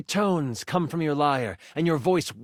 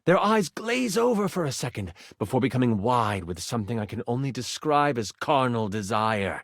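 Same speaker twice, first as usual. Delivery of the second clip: normal talking voice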